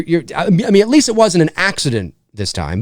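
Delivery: normal talking voice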